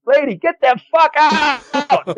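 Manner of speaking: high, slow, drawn-out comic yell; imitated exasperated yell. Cleanup guy ejecting an arguing customer, drawn-out mock yell. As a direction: stretch the order into a yell. Cleaner's outburst acted again.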